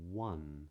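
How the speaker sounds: "one" opening on a rounded W, as wun; rounded W onset. Shown as a demonstration. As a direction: round the lips into a W before "one". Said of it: "and also words like".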